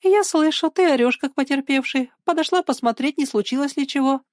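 put-on high-pitched girl's teasing voice; amused, mocking explanation. A narrator's reading. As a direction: needle him lightly, pitched well up. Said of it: "Её глаза продолжали смеяться".

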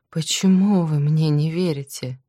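plain reading voice

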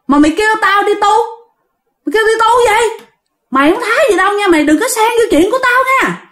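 high-pitched, indignant telling-off; defiant anger. Acted. Ghost warns the exorcist to stay out, indignant.

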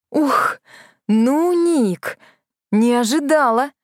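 gasp, caught off guard; gasping in surprise. A narrator's reading. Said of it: "ахнула".

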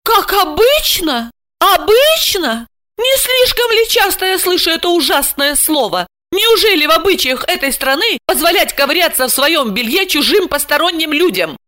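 seething indignation. A narrator's reading. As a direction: throw it back at her, scandalised. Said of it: "Александра кипела от гнева". (кипя от гнева, высоким голосом)

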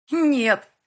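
very high, loud cry; girl screaming as he tears her shirt; scream of refusal. Acted. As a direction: scream no as he grabs you. Girl screams during the attack.

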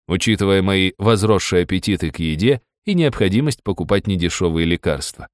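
ordinary talk

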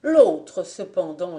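ordinary delivery, nothing marked

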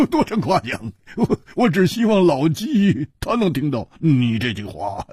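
high chuckling reply, slightly stammered; Hu Tiehua, amused and hearty. Acted. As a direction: laugh off the compliment. Plays Hu Tiehua answering praise.

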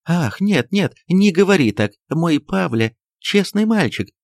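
high-pitched, affected wifely protest; exclaiming. A narrator's reading. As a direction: exclaim in pleading defence of your son. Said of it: "воскликнула жестом Софья Антоновна".

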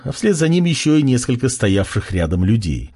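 ordinary delivery

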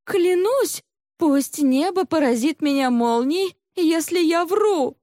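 high, earnest female swearing; fervent oath. A narrator's reading. Accused maid swears to heaven she is honest, fervently. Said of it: "поклялась небесам".

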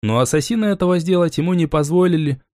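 ordinary delivery, nothing marked

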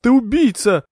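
high, loud accusing scream; shrieked, outraged answer; screamed retort. A narrator's reading. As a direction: fling the accusation back at full pitch. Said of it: "закричала в ответ Маргарет".

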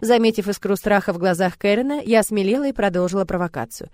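plain reading voice

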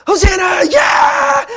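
very high, loud joyful cheer; cheering crowd. Retold or imitated. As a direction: cheer him in like a king. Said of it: "Earlier, like".

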